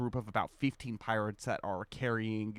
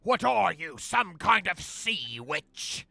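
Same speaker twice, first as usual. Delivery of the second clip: high, loud pirate outcry; alarmed pirate voice; 8 semitones higher, 9 dB louder, slower than usual. Acted. Pirates stop dead before glowing Luxalia, high loud challenge. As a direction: demand it loudly, stretching the last words.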